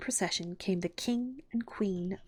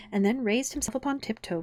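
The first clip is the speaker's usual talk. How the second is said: ordinary delivery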